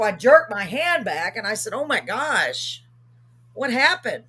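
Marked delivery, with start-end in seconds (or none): 1.7-4.2 drawn-out, higher startled question; mildly shocked, stretching out the words; retold freaked-out reaction. Recoiling from the old lady's skull, mildly freaked out. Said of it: "I was freaked out".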